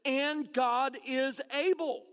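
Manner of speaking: And God is able, slow, ABLE stressed; slow emphatic refrain. Shown as a demonstration. Pastor encouraging those with big dreams, slow emphatic refrain. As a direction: drive it home on ABLE. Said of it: "Let me tell you today".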